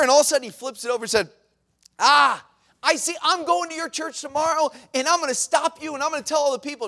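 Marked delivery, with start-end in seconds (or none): 2.0-6.9 threats. Retold or imitated. Stranger threatening to disrupt the church, high threatening voice. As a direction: threaten him, pleased to have found it. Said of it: "he started giving these threats".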